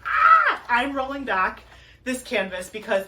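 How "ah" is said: short high squeal of delight; high joyful squeal; excited squeal. Real, not acted. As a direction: let out a sharp happy squeal. Unrolling canvas squeal.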